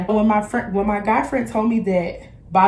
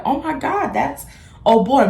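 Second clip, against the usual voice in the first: excited; 6 semitones higher than usual. Retold or imitated. Seeing crush on the court.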